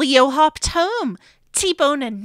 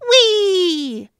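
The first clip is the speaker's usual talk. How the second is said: high, loud joyful whee; gleeful whee; 6 semitones higher, 6 dB louder than usual. Acted. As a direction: squeal with delight rolling downhill. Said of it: "Clifford said".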